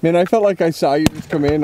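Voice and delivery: ordinary talk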